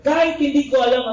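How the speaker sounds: ordinary talk, nothing marked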